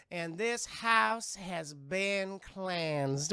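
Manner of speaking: very slow, solemn proclamation; Zelda the medium. Retold or imitated. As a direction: intone it grandly, word by word.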